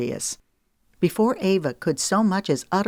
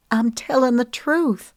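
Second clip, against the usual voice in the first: higher, louder, rushed and distressed; upset pleading; 8 semitones higher, 3 dB louder than usual. Acted. Woman begging her lover to believe her, higher and distressed.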